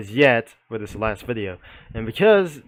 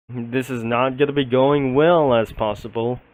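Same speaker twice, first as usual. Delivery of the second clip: normal talking voice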